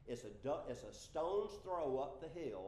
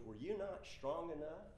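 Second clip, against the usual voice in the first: ordinary delivery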